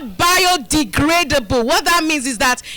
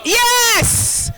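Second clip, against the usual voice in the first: excited yes; 7 semitones higher than usual. Real, not acted. Cheering the crowd's answer.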